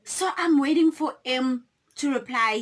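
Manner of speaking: normal talking voice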